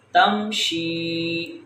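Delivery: تمشی slow, final ee held; long vowel. Shown as a demonstration. Teacher drills ya madda, تمشی drawn out. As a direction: stretch out the end of تمشی. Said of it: "ہم اس کو لمبا کر رہے".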